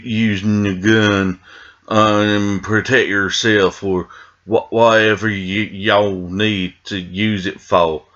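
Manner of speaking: put-on drawled Southern Georgia accent, slow at the end. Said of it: "I don't think that was a particularly Georgia accent".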